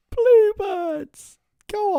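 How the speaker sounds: high, drawn-out club cheer; excited cheer. Real, not acted. Fan cheering his club's nickname in joy. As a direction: sing out the nickname.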